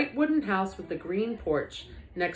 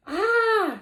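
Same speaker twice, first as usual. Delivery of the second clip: high, loud frightened shriek; a short, sharp cry of fright, pitched high; startled scream; 13 semitones higher, 5 dB louder than usual. A narrator's reading. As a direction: shriek at the sight of a monster. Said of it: "screamed Annie".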